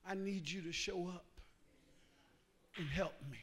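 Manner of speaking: low, very soft plea; hushed, subdued cry for help; quiet hurting prayer. Acted. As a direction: confide pain to God, barely raising the voice. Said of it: "It ain't got to be loud".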